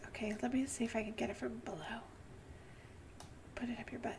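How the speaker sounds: fast hushed whisper. Real, not acted. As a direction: whisper it so nothing hears.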